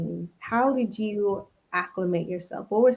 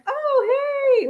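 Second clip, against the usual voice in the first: much higher, sing-song mock hello; fake-friendly greeting. Spaniards mocking American friendliness, high sing-song mock greeting. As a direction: parody the overly bubbly American greeting. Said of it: "we do this, like".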